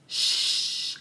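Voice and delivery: soft, drawn-out shush; a long, quiet "shh". Retold or imitated. Man coming through the mirror, soft shush. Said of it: "and he goes".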